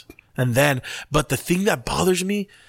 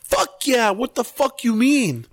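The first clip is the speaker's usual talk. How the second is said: high-pitched incredulous retort; indignant; 8 semitones higher than usual. Acted. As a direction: fire back in mock outrage, pitched high. Indignant reply to the mimicked pundit.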